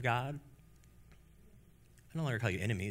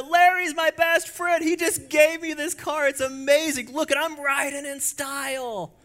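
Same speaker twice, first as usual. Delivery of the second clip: much higher, louder excited yelling; yelling out the window; 8 dB louder than usual. Pretend. Driver yelling about his friend's gift, much higher and louder. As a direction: shout your joy out the car window. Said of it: "you're yelling out the window".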